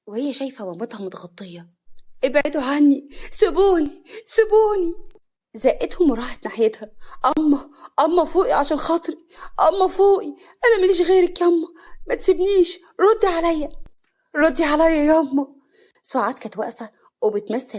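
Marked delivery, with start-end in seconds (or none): 2.2-4.9 high, loud desperate shouts, rising; hysterical, breaking down. A narrator's reading. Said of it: "وهي منهارة". 7.4-15.6 high, loud grief-stricken pleading; breaking down. A narrator's reading. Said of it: "وهي منهارة".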